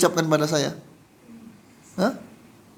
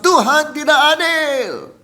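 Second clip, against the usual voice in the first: high, loud, drawn-out complaint; acted protest; 9 semitones higher, 6 dB louder, slower than usual. Preacher asking if we call God unfair, acted protest. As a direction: cry it out slowly as a mock accusation.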